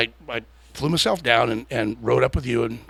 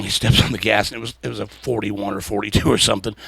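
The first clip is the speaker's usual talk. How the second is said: usual speaking voice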